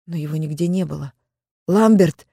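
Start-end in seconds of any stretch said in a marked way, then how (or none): 1.7-2.3 a scared cry of a name; frightened call. A narrator's reading. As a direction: call out in fear. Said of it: "испуганно позвала я".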